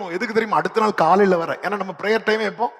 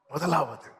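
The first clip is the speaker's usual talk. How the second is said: முதலாவது said softer and lower; soft repeat; 3 semitones lower, 7 dB quieter, slower than usual. Shown as a demonstration. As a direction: let முதலாவது drop quietly. (முதலாவது மெதுவாக)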